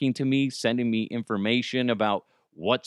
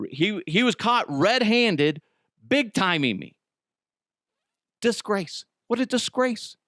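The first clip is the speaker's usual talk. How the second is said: high indignant mock rant; theatrical scolding; mock outrage; 9 semitones higher than usual. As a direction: play the wounded diva.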